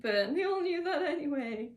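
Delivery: ordinary talk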